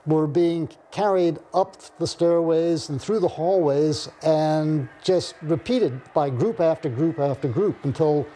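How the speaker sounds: ordinary delivery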